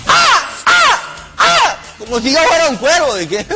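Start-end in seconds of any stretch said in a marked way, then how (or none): 0.1-1.8 high, shrill cawing like a crow; crow caw imitation. Grandson imitating his grandmother cawing at him like a crow. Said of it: "me hacía así".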